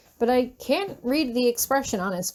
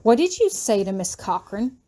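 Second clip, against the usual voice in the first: plain reading voice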